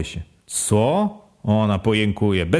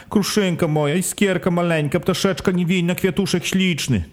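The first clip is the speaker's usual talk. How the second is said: higher keening voice for the wife; mourning mother's endearments; 8 semitones higher than usual. Acted. Wife lamenting over her dead baby, higher keening. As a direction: croon the pet names, higher, as a lament. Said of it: "A żonka".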